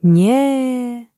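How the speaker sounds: put-on a small boy refusing to give up the phone; stubborn no. A narrator's reading. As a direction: refuse at once like a sulking boy. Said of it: "od razu zmarszczył brwi Wojtek".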